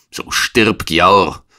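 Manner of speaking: laut und erhöht ausgestoßen; wütender Angriffsruf. A narrator's reading. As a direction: dem Gegner den Todesfluch entgegenschleudern. Angreifer wirft den Dolch. (zornig gerufen)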